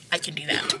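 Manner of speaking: soft, breathy whisper of resolve; a self-pep whisper before saying it aloud; whispered to herself. Retold or imitated. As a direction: whisper it like a private promise. Astrid psyching herself up.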